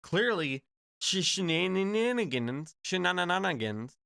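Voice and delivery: mock her with stretched, stuttery syllables; mocking a politician. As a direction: tease the word out slowly, tripping over its syllables. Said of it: "that word just makes me laugh when she's".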